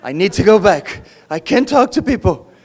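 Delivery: building from dismay to a loud, alarmed wail; mock panic building to a loud cry; panicked inner thought. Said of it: "I could only think".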